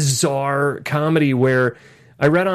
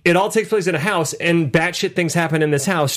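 ordinary talk, nothing marked

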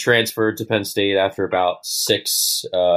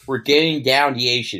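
Baltimore accent; 4 semitones higher than usual. Shown as a demonstration. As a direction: show off the Baltimore vowels, a bit higher. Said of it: "So all the A's are pronounced like this".

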